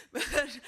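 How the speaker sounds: bursts out laughing, high and loud; hearty laugh. Real, not acted. Laughs at own joke.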